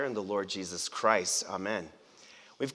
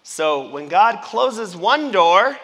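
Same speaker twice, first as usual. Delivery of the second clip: slow, louder, higher lead-in left hanging; prompting the crowd; 6 semitones higher, 9 dB louder, slower than usual. Shown as a demonstration. Pastor prompting the congregation to finish the saying, slow and louder. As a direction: draw the congregation into finishing the idiom. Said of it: "I'm gonna kinda start 'em and let you finish 'em".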